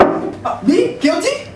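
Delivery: squeaky high "me? guilty?"; shocked innocence. Acted. Suspect to detective, high mock surprise. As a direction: play the innocent, all shock.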